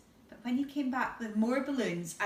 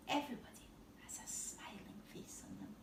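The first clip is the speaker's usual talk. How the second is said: soft quick whisper; whispered aside; 12 dB quieter, faster than usual. Real, not acted. Reader pointing out the picture, whispering. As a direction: whisper it warmly.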